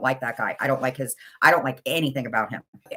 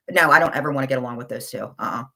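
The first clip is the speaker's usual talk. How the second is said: ordinary talk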